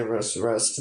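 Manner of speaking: ordinary delivery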